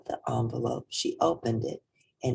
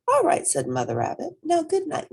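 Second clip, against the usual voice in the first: high, louder, cheerful; mother rabbit; 13 semitones higher, 4 dB louder than usual. A narrator's reading. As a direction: agree brightly as mother.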